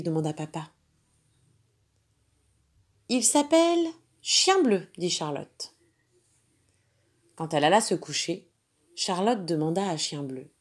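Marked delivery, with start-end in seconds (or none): 3.1-4.9 put-on much higher proud little girl voice. Girl telling papa the dog's name, proud. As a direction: announce the name proudly like a child.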